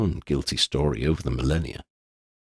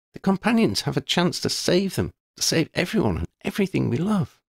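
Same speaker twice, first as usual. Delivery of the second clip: put-on higher young voice, dry and fond; girl's voice, amused then serious; Lydia, wry schoolgirl; 10 semitones higher than usual. A narrator's reading. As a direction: tease her friend, then own up frankly. Lydia explains the danger.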